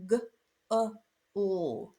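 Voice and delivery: G, UH, UL as three separate sounds; isolated sounds. Shown as a demonstration. Teacher showing what we hear in bugle, isolated sounds. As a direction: say g, uh, ul one sound at a time, with pauses. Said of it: "we actually hear".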